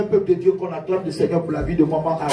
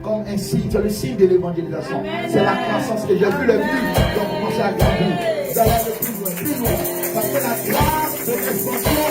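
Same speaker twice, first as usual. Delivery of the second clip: preaching at full force; 6 semitones higher than usual. Acted. Preacher rousing the congregation.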